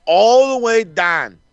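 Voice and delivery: high, slow, drawn-out awe; excited emphasis. Real, not acted. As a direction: savour every word of the move. Excited recap of suplex.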